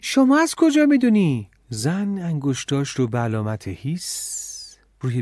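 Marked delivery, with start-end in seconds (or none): 0.0-1.5 very high, loud startled screech; shriek. A narrator's reading. Emma startled that the headmistress knows his name, shrieking. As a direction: shriek the question out. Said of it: "اما جیغ زد و گفت".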